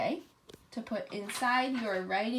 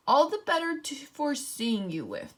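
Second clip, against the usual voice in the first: wolf faking a high, wobbly granny voice; strange wobbly voice; 4 semitones higher, 4 dB louder than usual. Acted. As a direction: pretend to be a frail old granny. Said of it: "in the same wobbly voice".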